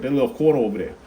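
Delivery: tossing it off in mock Jersey; light Jersey accent. Shown as a demonstration. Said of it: "sounds like it needs to be said in, like, a Jersey accent".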